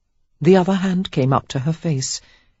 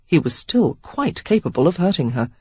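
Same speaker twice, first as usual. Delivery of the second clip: ordinary delivery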